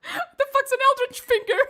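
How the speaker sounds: high, loud, breaking into laughter; laughing. Real, not acted. Cracking up at his own Eldritch Finger slip, laughing hard. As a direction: squeal it out through real giggles.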